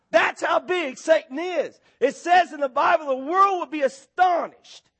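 high, driving pulpit preaching; preaching at full force. Acted. Pastor to his congregation, preaching at full force.

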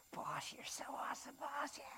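high whispery henchman; squeaky-soft sycophantic imp; fawning imp. Acted. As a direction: gush over the boss in a tiny whisper. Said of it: "the little imp comes over".

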